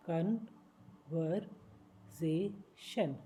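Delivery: Conversation stretched very slowly, each syllable apart. Shown as a demonstration. Teacher slowing conversation down for learners. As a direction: break conversation into slow pieces.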